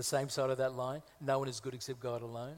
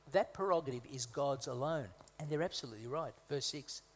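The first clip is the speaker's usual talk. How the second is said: normal talking voice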